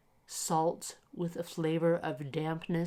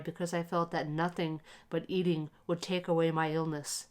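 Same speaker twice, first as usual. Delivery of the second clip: usual speaking voice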